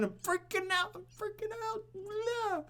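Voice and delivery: high, thin squeaky panic; mock panic squeal. Streamer squealing mock panic over the crashing market. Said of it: "I'm freaking out, man".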